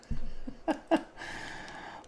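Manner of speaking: high breathy giggle; breathy laugh. Real, not acted. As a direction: let out an airy laugh. Laughs at viewer comment.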